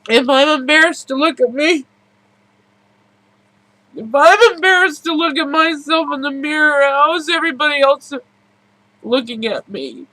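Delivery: high, pained, tearful voice; pushing through tears. Real, not acted. Emotional confession, rising intensity.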